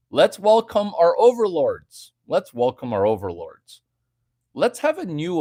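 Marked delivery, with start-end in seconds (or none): 0.1-2.1 hammed-up grand welcome; mock proclamation, louder, higher. Streamer to chat, proclaiming a mock welcome loudly. 2.3-3.8 dropped low and dry; deadpan it low; lower repeat. Acted. Streamer to chat, repeating the mock welcome lower.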